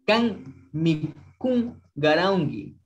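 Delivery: Kan mikun garaungi, slowly, k voiced as g; drawn-out teacher model, garaungi with a g; slow and clear for students to copy; slow model pronunciation. Shown as a demonstration. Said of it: "se le pronuncia con la G".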